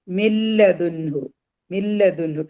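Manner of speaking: മില്ലതുൻഹു slow, doubled l at the start; merged without nasal. Shown as a demonstration. Teacher demonstrates the merge, മില്ലതുൻഹു with 'mil-la' joined. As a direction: recite മില്ലതുൻഹു slowly, first n silent. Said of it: "ഇനി നൂനിന് എന്ത് ചെയ്യല?".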